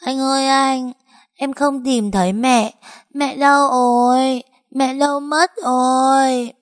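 put-on high, slow, tearful child's plea; a lost little girl begging for her mom; child sobbing, drawing out each word; whimpering cry. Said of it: "mếu máo gọi".

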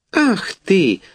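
a cook pinched from behind; mild startled exclamation; startled gasp. A narrator's reading. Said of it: "ахнула стрепуха".

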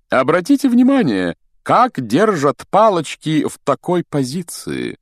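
high, loud demonstrator's voice; showy explaining. A narrator's reading. Pompous teacher explaining wand position to hushed pupils, showy. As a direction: explain grandly to a hall, pitched high. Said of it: "объяснял Локонс притихшим ученикам".

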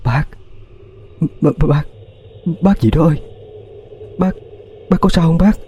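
high, stuttering frightened question; girl stammering as she nudges the old woman. Acted. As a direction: stumble over every word in fear. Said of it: "miệng lắp bắp hỏi".

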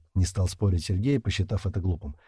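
ordinary delivery, nothing marked

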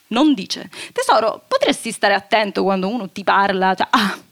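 ordinary talk, nothing marked

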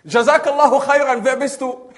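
high, loud friendly call; called-out thanks. Acted. Man to unseen stranger in forest, loud call. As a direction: project the blessing across the woods.

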